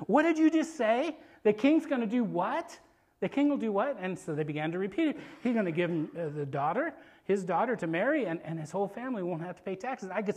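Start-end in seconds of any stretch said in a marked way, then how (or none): 0.1-4.0 high, eager disbelief; raised, incredulous double-take; astonished questioning. Acted. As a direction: act stunned by the news. David astonished at the reward.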